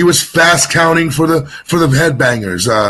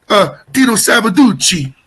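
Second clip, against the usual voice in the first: "Tito Savaducci" in a light, higher Jersey Italian uncle voice; Tony Danza-style uncle; 5 semitones higher than usual. Retold or imitated. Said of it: "he talks like Tony Danza".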